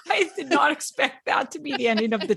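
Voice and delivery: talking through a real laugh; giggling at the story's twist. Real, not acted. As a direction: laugh out the words, amused. Laugh heard while reacting.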